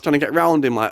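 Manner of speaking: ordinary delivery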